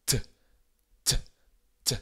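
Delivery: T, t, t with a little delay before the air comes out; delayed T release. Shown as a demonstration. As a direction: hold the T, then let the air out. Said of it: "un petit délai entre le moment où je commence à faire mon T".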